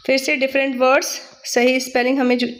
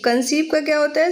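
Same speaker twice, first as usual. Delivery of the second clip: usual speaking voice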